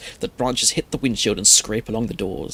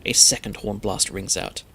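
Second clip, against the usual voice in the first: usual speaking voice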